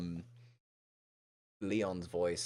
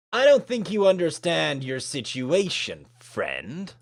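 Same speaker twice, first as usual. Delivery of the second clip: louder, higher acted retort; confrontational; 10 semitones higher, 11 dB louder, slower than usual. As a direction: push back at a rival. Rival confronts the new student.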